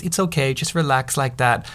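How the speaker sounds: softly voiced reassurance; gentle, hushed bedside manner; soothing nurse. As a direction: imitate a nurse calming a patient. Nurse calming a nervous boy.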